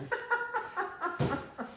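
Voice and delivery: sudden high-pitched giggle; high self-mocking laugh. Real, not acted. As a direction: laugh at your own joke, up high. Jokes about looking rich.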